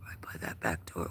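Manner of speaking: very soft unvoiced whisper; hushed whisper near the door. Real, not acted. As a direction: breathe it out quietly. Whispering at night outdoors.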